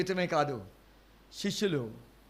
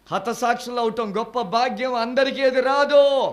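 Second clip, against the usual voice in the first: high, loud and slow, hammering రాదు; preaching at full force; 6 semitones higher, 9 dB louder, slower than usual. Acted. Preacher telling the church martyrdom is rare, loud and slow. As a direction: declare it slowly to the whole congregation.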